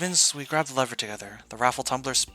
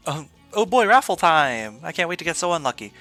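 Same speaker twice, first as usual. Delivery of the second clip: sarcastic excitement; 4 semitones higher, 8 dB louder than usual. Real, not acted. Raffle time, expecting bad luck.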